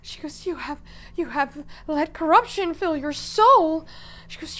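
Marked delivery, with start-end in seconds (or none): 0.5-3.9 high and loud, building outrage; outraged accusation. Acted. Odette condemning Milos for his corruption, outraged. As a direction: condemn him at a raised, high pitch.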